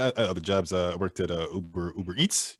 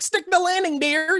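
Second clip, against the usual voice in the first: high, louder cheering mom voice; supportive mother; 5 dB louder, slower than usual. Acted. Mother prompting the performer from the audience, high supportive cheer. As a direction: call it out high like a doting mom. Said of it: "Your very supportive mother".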